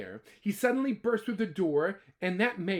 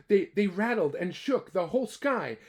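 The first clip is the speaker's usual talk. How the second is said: usual speaking voice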